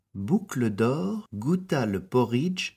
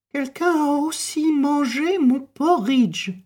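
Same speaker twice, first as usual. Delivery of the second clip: high, louder mother bear; Mama Bear; 5 dB louder than usual. A narrator's reading. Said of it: "s'exclama Maman Ours". (voix aiguë et forte de Maman Ours)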